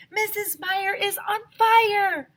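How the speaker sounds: high, loud, slow cry; frantic alarm; panicked yell. A narrator's reading. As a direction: shout the alarm. Panic in story.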